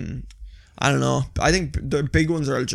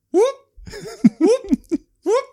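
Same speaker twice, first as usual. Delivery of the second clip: very high rising whoop; imitated dog noise; slower than usual. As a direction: mimic a dog's attention-seeking whoop. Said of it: "making the noise that the dog makes".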